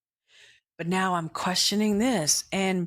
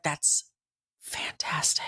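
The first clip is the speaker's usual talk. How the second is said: awed whisper; 4 semitones lower, 4 dB quieter, slower than usual. Retold or imitated. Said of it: "she's like".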